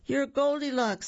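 high-pitched exclaiming little pigs; pigs in chorus. A narrator's reading. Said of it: "said the three little pigs together".

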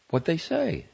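high, impatient question. A narrator's reading. Guest asking matron of honor about the call, impatiently. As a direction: press impatiently for news. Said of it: "she asked impatiently".

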